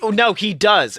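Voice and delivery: ordinary talk, nothing marked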